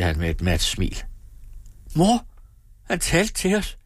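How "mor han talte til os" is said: joyful cry. A narrator's reading. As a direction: call out to mother in sudden delight. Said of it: "råbte Karen med fryd i stemmen".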